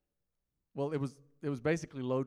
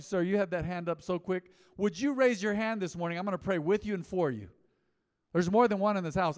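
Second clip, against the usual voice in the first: ordinary talk, nothing marked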